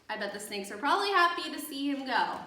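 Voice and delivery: ordinary delivery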